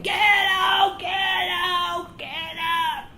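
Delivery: high, drawn-out retold screech; mock screaming. Lady doctor ordering new assistant out, mock screaming. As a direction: screech it like she did, not full volume. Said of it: "she starts screaming".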